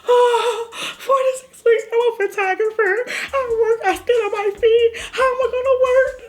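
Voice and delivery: crying wail. Retold or imitated. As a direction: sob out the panic about losing work. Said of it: "bust out crying".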